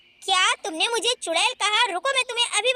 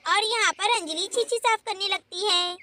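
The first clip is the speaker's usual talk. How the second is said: usual speaking voice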